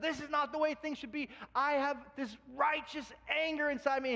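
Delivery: high-pitched righteous indignation; righteous anger. Retold or imitated. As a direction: voice Moses burning with anger. Moses angry at slave driver.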